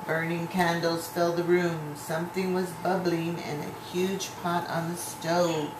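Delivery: ordinary delivery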